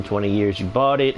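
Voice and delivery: ordinary talk, nothing marked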